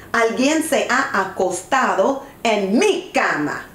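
ordinary delivery, nothing marked

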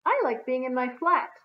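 loud, high-pitched moan; plaintive storybook moan. A narrator's reading. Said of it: "she moaned".

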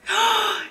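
sharp high intake of breath; excited gasp. Real, not acted. Vlogger seeing it is noon, excited gasp. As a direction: gasp with sudden thrilled surprise.

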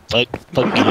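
mocking a stuffed-up voice; congested impression. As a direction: imitate someone with a blocked nose, laughing. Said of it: "he sounds like he has congestion".

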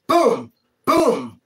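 punch sound effect. Retold or imitated. Punches landing, imitated sound.

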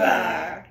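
mock roar. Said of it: "What sound does a tiger make".

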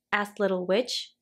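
usual speaking voice

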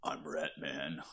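low, slow, soft superhero parody; Rat Man voice. Retold or imitated. Co-host pictures his friend as Rat Man, low mock-hero voice. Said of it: "I'm picturing you as, like".

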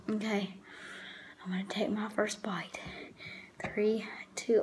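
hushed, nervous countdown; whispering. Real, not acted. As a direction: whisper it before the bite. Nervous before the first bite.

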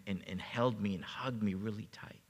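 ordinary talk

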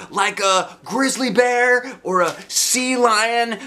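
put-on high, loud kid pitch; eager boy. A narrator's reading. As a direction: pitch the idea like an excited boy. Roger voiced.

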